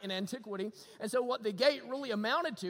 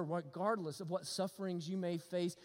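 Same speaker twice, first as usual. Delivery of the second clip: ordinary talk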